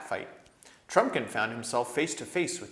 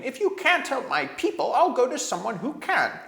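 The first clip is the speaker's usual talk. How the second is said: high, loud, near-screaming threat; dwarf screaming his threat at the council; rising to a scream; 8 dB louder than usual. A narrator's reading. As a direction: let the dwarf's voice climb into a scream. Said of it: "whose voice now rose to a scream".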